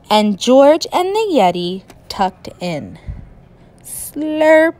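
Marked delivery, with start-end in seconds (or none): 3.8-4.7 slurp. Acted. Eating spaghetti sound.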